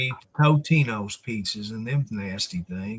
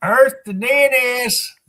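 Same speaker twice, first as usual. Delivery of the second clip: calling out; 10 semitones higher, 5 dB louder than usual. Pretend. Calling out over radio.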